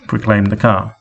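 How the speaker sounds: ordinary delivery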